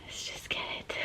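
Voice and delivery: very soft, voiceless whisper; real nerves, barely audible; nervous whisper. Real, not acted. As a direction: whisper it to brace yourself. Nervous before opening the photos.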